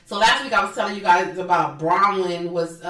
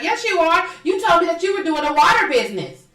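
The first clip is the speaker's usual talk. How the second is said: put-on high, childish insisting voice; mocking nagging housewife; 9 semitones higher, 4 dB louder than usual. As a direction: taunt like a bratty kid, pitched way up. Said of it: "that's the way she talks".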